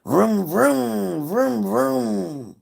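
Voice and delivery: playful motor sound; engine noise. Shown as a demonstration. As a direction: rev like a car engine. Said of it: "And I'm gonna say".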